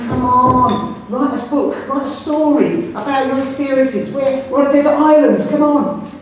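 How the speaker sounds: hammed-up teacher urging kids to write; mock pushy teacher. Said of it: "Not in the classroom saying".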